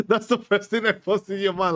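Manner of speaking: high, loud talk through a laugh. Real, not acted. Soldier recalling his first worried thought, laughing. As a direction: laugh your way into the words.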